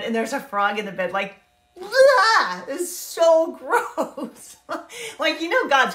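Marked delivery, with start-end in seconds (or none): 1.8-2.6 loud, high mock gag of disgust; disgusted blah. Grossed out by a frog in the bread dough, loud high blah. 2.7-4.6 slow, laughing through the words; laughing gross. Real, not acted. Laughing as she tells the church about frogs, so gross.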